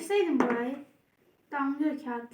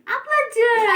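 high, loud scolding call; shout; 10 semitones higher, 11 dB louder than usual. Real, not acted. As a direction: yell at the little sister. Scolding the little sister.

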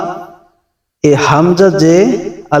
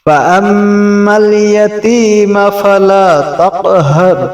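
high, melodic chanted recitation of the surah; Quran recitation; 3 semitones higher, slower than usual. Acted.

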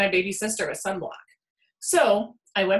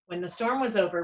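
ordinary delivery, nothing marked

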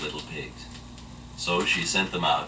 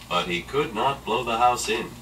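ordinary delivery

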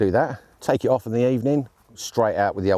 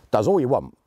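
ordinary talk, nothing marked

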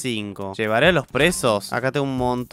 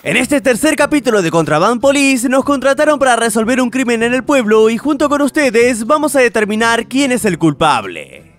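upbeat gamer intro voice; energetic video intro; intro narration; 8 semitones higher, 4 dB louder than usual. Acted.

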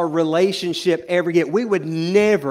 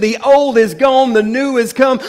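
loud, impassioned sermon delivery; full preaching; 5 semitones higher, 7 dB louder than usual. Acted.

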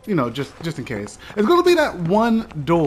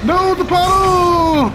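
long, high drawn-out yell; anguished shout; 6 dB louder, slower than usual. Real, not acted. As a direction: wail it out in despair. Falls into puddle, shouting.

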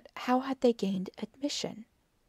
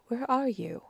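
higher-pitched searching call; mild cry; 4 semitones higher than usual. A narrator's reading. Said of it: "I cried".

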